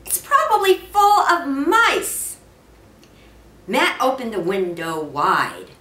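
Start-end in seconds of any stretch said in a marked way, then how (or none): 0.1-2.4 higher, lightly voiced complaining mother; a touch of a wife's protest, pitched up; mother pleading with her husband, mildly voiced higher. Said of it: "Missus Gridley made one last protest".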